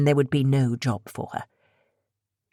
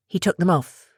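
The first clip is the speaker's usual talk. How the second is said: plain reading voice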